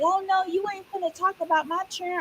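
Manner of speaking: high, mock-indignant mother voice; lady defending her kids, voiced high; offended mother. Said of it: "She's like".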